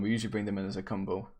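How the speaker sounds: ordinary talk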